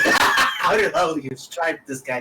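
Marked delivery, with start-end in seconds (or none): 0.0-1.1 loud, laughing disbelief; laughing shout. Real, not acted. Laughing at character's voice.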